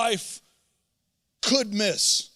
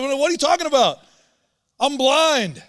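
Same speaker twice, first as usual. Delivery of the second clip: exasperated; 6 semitones higher, 4 dB louder than usual. Retold or imitated. Blind man's exasperated retort.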